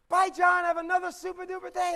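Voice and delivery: loud, high, sing-out farewell; over-caffeinated cheer. Acted. Clerk calling goodbye to her favorite customer, loudly chirpy. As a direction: call out a hammed-up perky goodbye. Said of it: "she had too much coffee".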